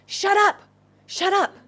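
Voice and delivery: high, loud, sharp command; crowd silencing him. Acted. Crowd tells the blind beggar to be silent, sharp shout. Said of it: "really what they were saying was".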